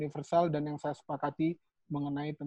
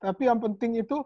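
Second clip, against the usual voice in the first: usual speaking voice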